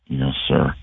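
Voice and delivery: low mumbled yes sir; low, quiet answer. Retold or imitated. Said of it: "Like, very low".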